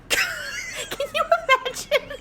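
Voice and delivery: high, squeaky words through laughter; real helpless laughing. Real, not acted. Retelling a Bible lady's scolding, laughing helplessly. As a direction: try to get the quote out while cracking up. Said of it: "I can't even do it without laughing".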